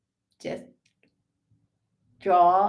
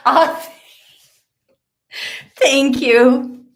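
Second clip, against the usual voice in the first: excited thanks; 8 semitones higher, 11 dB louder, much slower than usual. Real, not acted.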